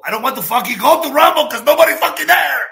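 loud frustrated rant; angry outburst. Real, not acted. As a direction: blow up at the idea.

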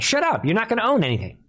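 heated fast repeat. Real, not acted.

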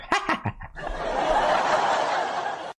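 breathy high-pitched giggle; high laugh. Real, not acted. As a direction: burst out in a squealing laugh. Laughing at the news.

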